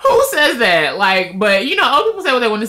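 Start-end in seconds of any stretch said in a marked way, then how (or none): 0.1-1.0 amused disbelief. Real, not acted.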